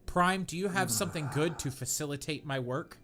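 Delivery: ordinary talk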